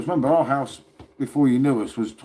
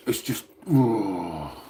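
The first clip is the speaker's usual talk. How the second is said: very slow, lower, crying-edged sigh of defeat; despairing groan; 3 semitones lower, much slower than usual. Real, not acted. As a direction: let it sag into a pained moan. Despairing over heavy defeat.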